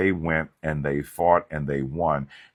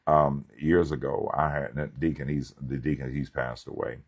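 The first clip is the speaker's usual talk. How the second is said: ordinary talk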